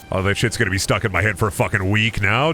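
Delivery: ordinary talk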